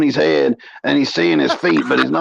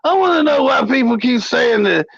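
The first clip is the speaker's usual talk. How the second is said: much higher, whiny puzzled voice; imitating Rebel's question; 7 semitones higher than usual. Rebel asking Mo about the pizza rumour, mocked in a high whine. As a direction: pitch it up like a baffled complainer. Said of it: "He goes".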